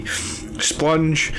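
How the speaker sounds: normal talking voice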